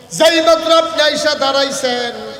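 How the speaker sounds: high, loud preacher's retelling; dramatic outcry. Retold or imitated. Wife tells her husband what happened, loud dramatic outcry. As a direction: lift it suddenly to a loud cry. Dramatic peak of wife's retold account.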